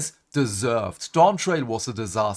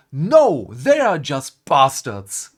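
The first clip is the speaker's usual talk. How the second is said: emphatic outburst; 7 dB louder, slower than usual. Real, not acted. Ranting about villains.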